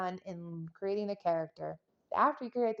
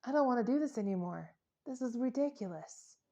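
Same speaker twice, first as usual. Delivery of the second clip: fed up; 4 dB louder than usual. Retold or imitated. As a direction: sigh out being completely done. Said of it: "coming out of this woods going like".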